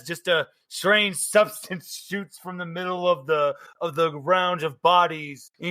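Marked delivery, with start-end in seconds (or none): none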